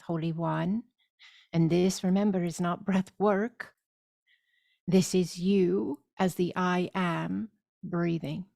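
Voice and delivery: ordinary delivery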